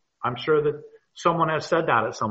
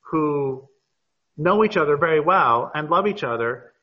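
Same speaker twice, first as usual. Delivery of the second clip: normal talking voice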